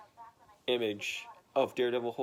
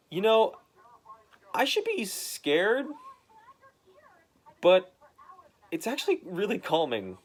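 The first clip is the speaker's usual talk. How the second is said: 1 light inner monologue; 8 semitones higher than usual. Retold or imitated. Falling man's inner monologue.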